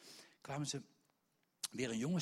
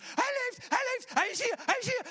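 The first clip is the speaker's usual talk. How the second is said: very high, loud jubilant cry; joyful shout; 15 dB louder than usual. Retold or imitated. The whole church crying out that Peter is alive, very high and loud. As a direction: burst out in joy.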